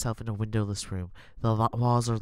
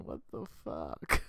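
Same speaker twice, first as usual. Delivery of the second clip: muttered disbelief; 7 semitones lower, 7 dB quieter, slower than usual. Real, not acted.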